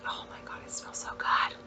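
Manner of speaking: hushed awed whisper. Real, not acted. Sniffing a new wax melt, whispering to viewers in awe. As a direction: breathe it out, overwhelmed by the smell.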